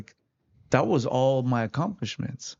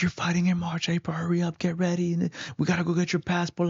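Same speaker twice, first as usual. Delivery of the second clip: raised pitch, lightly imitated urgency; excited quoted news, pitched higher; enthusiastic coach; 6 semitones higher than usual. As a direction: retell a coach hurrying his fighter along. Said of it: "He was very enthusiastic".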